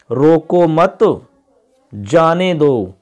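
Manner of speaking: रोको मत as one phrase, long gap, slow जाने दो; pause after मत. Shown as a demonstration. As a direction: break after मत, stretch जाने दो. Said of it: "इसी वाक्य को हम इस प्रकार बोलते हैं".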